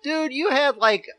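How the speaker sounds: high, loud incredulous scoff; mock the fake claim; Percy's mocking voice. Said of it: "And Percy said".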